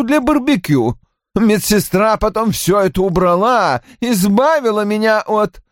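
high-pitched, complaining character voice; peevish man recalling a ruined day; querulous husband. A narrator's reading. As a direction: pitch it up and grumble about the disaster.

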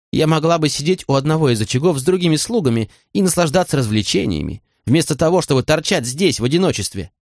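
understated anger of a hurt woman; contained fury. A narrator's reading. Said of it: "ярость в её голосе утихла".

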